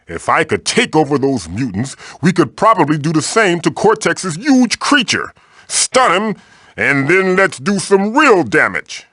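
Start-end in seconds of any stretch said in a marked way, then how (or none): none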